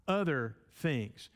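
"other" leaned on, read slowly; slow and weighted on "other"; stress on other. Shown as a demonstration. Preacher pointing out the inserted word, slowly stressing "other". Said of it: "they insert the word other to emphasize".

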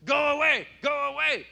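put-on high, loud child shouting go away; imitating toddler's tantrum. Pastor imitating his toddler son fighting the barber, shrill shout. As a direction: yell it like a two-year-old at the barber. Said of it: "He's swinging at the woman".

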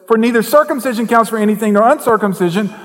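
verse proclaimed high and loud in a fervent preaching voice; preached verse. Shown as a demonstration. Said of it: "same verse read again".

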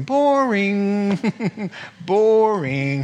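drawn-out, whiny boring; mock bored whine; bored moan.

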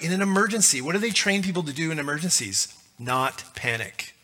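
ordinary delivery

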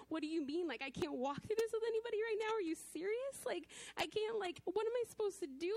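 pleading with God as tears come; voice breaking. Real, not acted. Crying out to God on the verge of tears. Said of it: "I'm not gonna make it through without crying".

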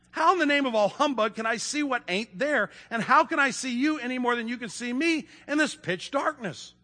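high, emphatic dwarf grumble; acted exasperated outburst; indignant retort. A narrator's reading. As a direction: argue back in disbelief. Dwarf angrily retorts to Lucy.

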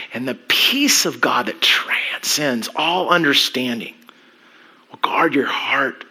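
soft, intimate sermon voice dropping to whispers; quiet altar-call delivery; hushed preaching. Acted. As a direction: draw the congregation close, speak just above a breath.

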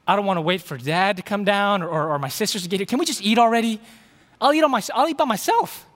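loud, high, mock-impatient pleading; impatient kid. Son begging Mom to start the family feast, impatient. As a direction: build to a high, hungry kid's protest.

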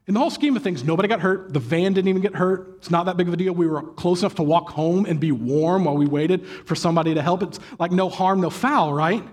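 normal talking voice

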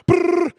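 loud rolled brrr; imitated flopping noise. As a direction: buzz the lips like something flapping. Imitates woman flopping like a fish.

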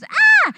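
put-on a child's sudden high, loud scream of fright; startled scream. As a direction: shriek like a little girl startled by the doll. Little girl screams at doll.

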